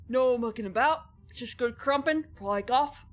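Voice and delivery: loud, jaw-out British gang voice; British slang voice. Pretend. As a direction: bark it like a London gang member. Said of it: "is that what it's supposed to be like?".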